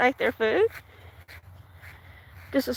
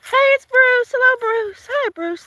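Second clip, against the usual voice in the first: excited greeting; 10 semitones higher than usual. Real, not acted. Spotting a viewer arrive.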